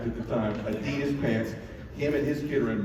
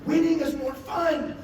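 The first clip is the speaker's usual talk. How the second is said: high, slow, drawn-out holler; excited hype. Retold or imitated. Dad preaching winning to son, excited hype. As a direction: holler it like a life lesson. Hyping up his son, retold.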